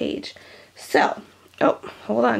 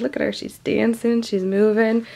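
ordinary talk, nothing marked

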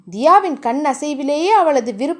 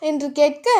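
ordinary delivery, nothing marked

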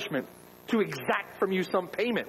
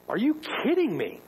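higher, incredulous "are you kidding me"; shocked disbelief; 6 semitones higher than usual. Acted. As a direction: sound stunned, like you can't believe it. Preacher voices shocked disbelief.

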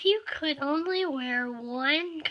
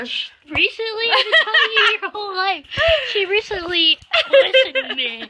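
loud, laughing tease; 7 semitones higher, 11 dB louder than usual. Real, not acted. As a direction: scoff through laughter. Laughing teasing retort.

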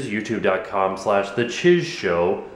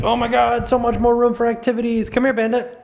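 high, thrilled voice for an eager pet; put-on high doggy excitement; excited dog voice; 11 semitones higher, 3 dB louder than usual. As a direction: pitch way up as an overexcited dog. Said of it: "Bandit's like".

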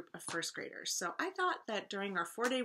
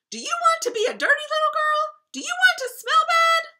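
very high, very loud scolding; scolding nanny; 18 dB louder than usual. A narrator's reading. Nanny shaking her finger at the princess, scolding. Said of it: "shook her finger and said".

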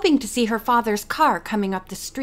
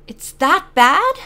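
louder, higher, slow question; alarmed disbelief; 5 semitones higher, 8 dB louder, slower than usual. A narrator's reading. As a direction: ask it wide-eyed, stretching the words. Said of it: "her eyes growing wide".

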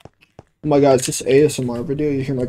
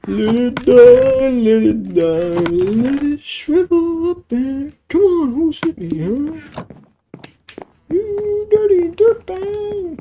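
high, slow cartoon villain egging on the crowd; squeaky slow mock villain rallying cry; goofy villain voice; 12 semitones higher, 3 dB louder, slower than usual. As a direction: ham it up as a sneering cartoon baddie. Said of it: "I'm sound really annoying".

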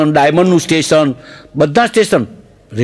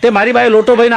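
ordinary delivery

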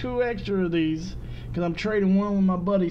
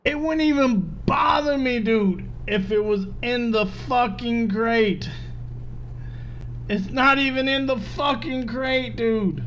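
exasperated rant; 6 semitones higher than usual. Real, not acted. Loot crate disappointment rant.

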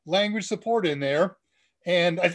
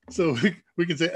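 ordinary talk, nothing marked

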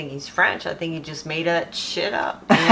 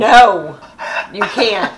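raised, higher-pitched veto; loud refusal; 4 semitones higher, 10 dB louder, much slower than usual. Real, not acted. Mom forbidding her child to post it, loud refusal. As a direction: forbid it loudly.

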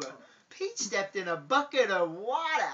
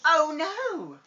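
hammed-up oh no; mock alarm; 7 semitones higher, 9 dB louder than usual. A narrator's reading. Dad reading to his kids, hammed-up alarm. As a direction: act playfully shocked.

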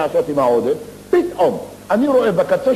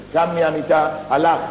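usual speaking voice

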